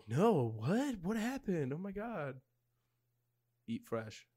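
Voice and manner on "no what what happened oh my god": feigned innocence. Retold or imitated. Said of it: "we were like".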